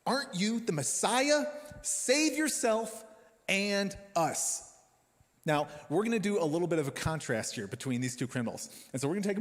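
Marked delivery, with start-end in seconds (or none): 0.1-4.7 high, slow sneering taunt, pitch way up; mocking insult. Criminal on a cross taunting Jesus, high mocking sneer. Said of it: "pure contempt and cynicism".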